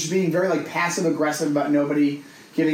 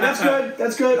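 firm call out; 8 semitones higher, slower than usual. Retold or imitated. Telling the woman to stop.

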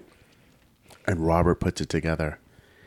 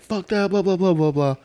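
high mock-angry rant; hammed-up high-pitched tantrum; losing it; 10 semitones higher than usual. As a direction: rattle off a ranting imitation, pitched up. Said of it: "losing his shit".

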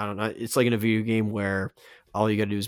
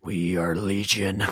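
slow, drawn-out, intense chant; super intense Avatar voice; slower than usual. Acted. Host mimics all the past Avatars echoing in, super intense voice. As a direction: intone it as many past Avatars speaking as one. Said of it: "in a super intense, uh, voice".

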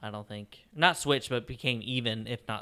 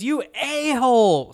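slow, much higher and louder furious yell; retold scream; 10 semitones higher, 8 dB louder, much slower than usual. Angry woman cursing the driver who cut her off, mock screaming. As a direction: stretch out the insult in a mock scream.

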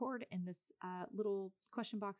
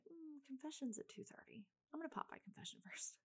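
higher, much softer, conspiratorial whisper; private whisper; 8 semitones higher, 7 dB quieter than usual. Retold or imitated. To herself on the way to Lowe's, a sly whisper. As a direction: whisper it to yourself like a sudden plan. Inner thought, deciding on confession.

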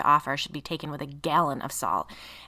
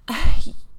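loud, breathy theatrical sigh; dramatic sigh; 15 dB louder than usual. A narrator's reading. Lily before doubting Liz is for real, sighing dramatically. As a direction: sigh for an audience. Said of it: "Lily sighed dramatically".

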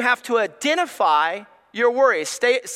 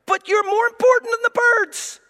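higher, louder earnest voice; voicing Jesus; 10 semitones higher, 4 dB louder than usual. Jesus telling the congregation they matter more than birds, raised and earnest. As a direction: lift up and insist warmly. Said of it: "And Jesus says".

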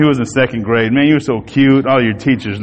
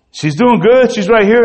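higher, easy mother's answer; calm mother; 9 semitones higher than usual. Retold or imitated. Mother telling the father on the phone she's fine, higher. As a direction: reassure lightly, voice up. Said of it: "she says".

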